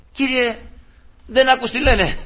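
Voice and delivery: high-pitched pleading complaint; indignant protest. Retold or imitated. Preacher complains to the Lord about the cursers, indignant protest. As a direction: protest as if wronged.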